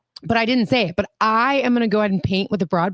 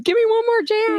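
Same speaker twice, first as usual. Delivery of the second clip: mock pleading fan; 14 semitones higher than usual. Co-host mimicking the fan begging, high pleading. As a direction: beg in a squeaky high voice. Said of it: "You're like".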